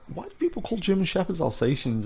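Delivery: put-on high, wondering kid's question; puzzled child voice. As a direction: ask it like a confused kid.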